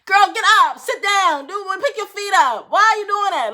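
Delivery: high, loud nagging impression; mock the lecturing woman, way up high; mocking lecture. Mocking Natalie yelling at the unresponsive girl, high and loud. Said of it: "lecturing a body that's dead".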